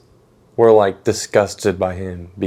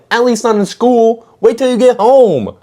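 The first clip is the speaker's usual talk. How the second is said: mock scolding; 12 semitones higher, 5 dB louder than usual. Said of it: "And I'm like".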